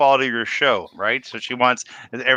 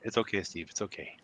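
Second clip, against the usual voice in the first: soothing; 7 semitones lower, 17 dB quieter than usual. Pretend. Mock comforting the host.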